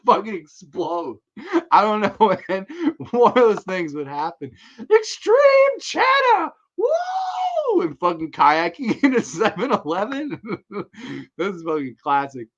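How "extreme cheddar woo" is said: very high, slow, stretched party shout; hyped shout. Retold or imitated. Quoting the movie's party scene with a long stretched yell. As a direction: yell it like a wild cheer.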